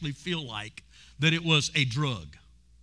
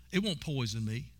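woman's inner thought; 7 semitones lower, 8 dB quieter than usual. Retold or imitated. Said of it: "this is what she thought".

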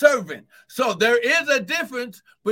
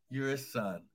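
hushed intimate; 9 semitones lower, 12 dB quieter than usual. Real, not acted. Preacher drops to hushed line.

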